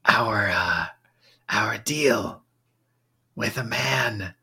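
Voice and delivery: secretive whisper; interrogating whisper. Acted.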